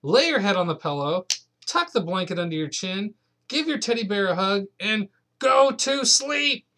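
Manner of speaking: granny losing patience line by line; rising frantic granny; building exasperated cry. Acted. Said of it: "cried Granny".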